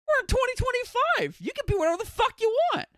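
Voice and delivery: very high, squealed protest; high-pitched indignation. Real, not acted. Streamer urging anyone can act female, high-pitched indignation.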